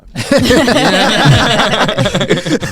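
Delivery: big high laugh bursting out; loud real laughter at his own story. Real, not acted. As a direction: laugh hard at the memory.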